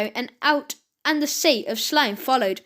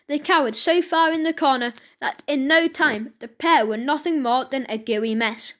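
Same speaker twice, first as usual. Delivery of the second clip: ordinary delivery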